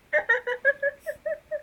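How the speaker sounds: real high laugh bursting out; high burst of laughter. Real, not acted. Laughing at the scene.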